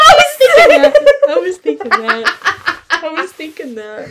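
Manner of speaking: laughing hard, high and loud. Real, not acted. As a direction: blurt it out through laughter. Laughing at the shared impression.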